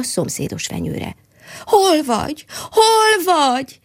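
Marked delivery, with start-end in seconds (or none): 1.6-2.5 a higher, frightened call into emptiness; desperate cry. Acted. Mole calls desperately for the squirrel, higher and frightened. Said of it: "kiáltotta kétségbe esetten". 2.7-3.8 much higher, louder, slow panicked wail; desperate cry. Acted. Mole cries out again in panic, much higher, louder and slow. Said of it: "kiáltotta kétségbe esetten".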